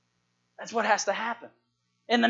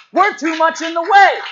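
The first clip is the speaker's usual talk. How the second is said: high, loud climactic cry; preaching shout; 11 semitones higher, 14 dB louder than usual. Real, not acted. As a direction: shout the point to the whole church.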